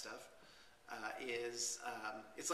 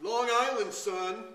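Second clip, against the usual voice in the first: higher, louder Long Island "son"; Long Island accent; 6 semitones higher, 8 dB louder, slower than usual. Retold or imitated. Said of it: "How does that go?".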